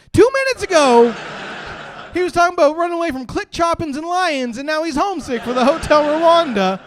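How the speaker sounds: high, very loud incredulous rant; outraged shouting. Acted. Comedian on the driver's sudden change of heart, outraged shouting. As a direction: yell it out in comic disbelief. Comic outburst over driver's despair.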